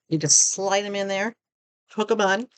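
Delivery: hiccuping between words; talking through hiccups. Real, not acted. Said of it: "I have the hiccups".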